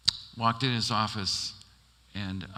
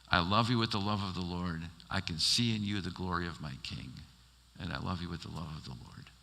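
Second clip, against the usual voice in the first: tender blessing; 5 semitones lower, 6 dB quieter than usual. Retold or imitated. Pastor voices loving blessing softly.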